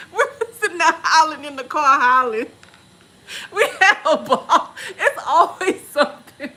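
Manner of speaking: high, loud, laughing through the words; laughing hard. Real, not acted. Friend recalling howling in the car, cracking up. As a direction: barely get it out through the laughter.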